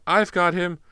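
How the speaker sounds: loud, high triumphant cry; shouting. Acted. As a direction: call it out across the room. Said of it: "Another voice cried".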